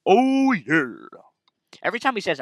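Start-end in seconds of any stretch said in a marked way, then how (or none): 0.1-1.1 Kool-Aid Man shout. Retold or imitated.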